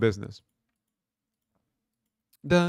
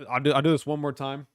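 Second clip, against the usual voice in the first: ordinary delivery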